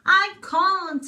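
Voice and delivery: high, loud angry snap; duck snaps. Acted. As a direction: snap back at the sheep. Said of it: "he snaps".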